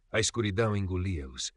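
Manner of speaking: ordinary delivery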